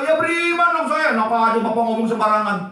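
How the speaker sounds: loud, high indignant comeback; mock outrage, pitched high and loud; angry retort. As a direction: imitate an offended believer snapping back loudly. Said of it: "Marah dia".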